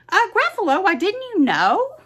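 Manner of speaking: tiny mouse feigning surprise; squeaky mouse. Acted. Mouse to the owl, a high surprised squeak.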